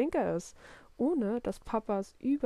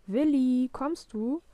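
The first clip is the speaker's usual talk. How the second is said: loud, raised call of the name; 4 semitones higher, 5 dB louder, slower than usual. A narrator's reading. Papa calls Willi from the kitchen, calling out. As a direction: call your kid from another room. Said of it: "ruft Papa wieder".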